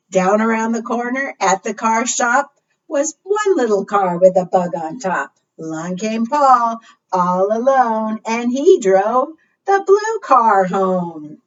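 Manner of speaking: singsong storytime read-aloud; reading to children. Acted. Librarian reads the car rhyme to children, lightly singsong. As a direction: read it out to the little ones.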